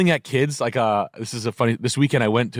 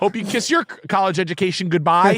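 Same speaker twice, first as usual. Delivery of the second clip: vindictive teacher; 7 semitones higher than usual. Acted. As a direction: gloat like a teacher ruining a student's future. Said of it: "a teacher would".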